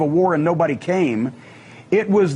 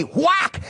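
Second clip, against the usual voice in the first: shouted whack sound; 10 semitones higher than usual. Retold or imitated. Retelling chair shot fight.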